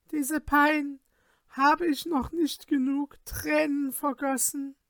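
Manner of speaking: high, pleading lover's lament; wailing lament. A narrator's reading. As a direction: plead for him to answer. Sorceress recites her lament verses.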